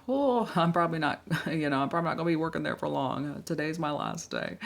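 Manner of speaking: acted huffy laugh, starting high and loud; mock quitting. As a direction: rattle off a scoffing resignation. Acted quitting reply to manager.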